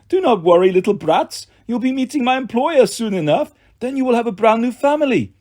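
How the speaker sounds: cold, courteous threat from the monster; lightly voiced monster villain. A narrator's reading. The monster to the captive kids, lightly menacing villain. Fawn mocks the orphans.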